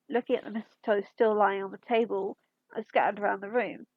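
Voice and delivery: ordinary delivery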